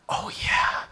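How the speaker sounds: conspiratorial mock whisper; soft, voiceless, eager whisper; whispered agreement. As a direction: whisper it like a plotting teen. Acting his sly teenage agreement.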